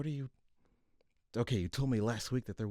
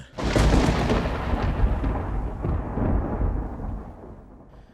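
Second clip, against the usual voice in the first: loud fed-up sigh; 8 dB louder than usual. Real, not acted.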